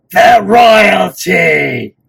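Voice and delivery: high, drawn-out shout in a put-on voice; attempted character battle cry. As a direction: belt it out slowly like a battle cry. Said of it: "I can't do that voice".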